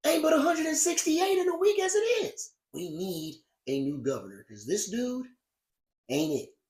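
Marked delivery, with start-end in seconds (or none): 0.1-2.5 incredulous aside. Acted.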